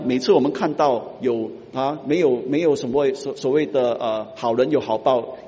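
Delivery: ordinary delivery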